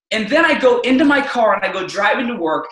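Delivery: usual speaking voice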